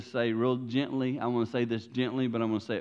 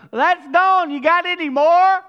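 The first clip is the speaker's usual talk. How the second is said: high, loud, begging for more; greedy plea; 13 dB louder, slower than usual. Retold or imitated. The world asking for more stimulus, high loud begging. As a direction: whine for more handouts.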